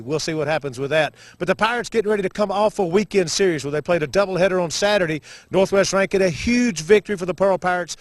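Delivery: upbeat play-by-play announcer read; sports broadcast intro. Acted. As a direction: open the broadcast like a radio host. Broadcaster opens the game show.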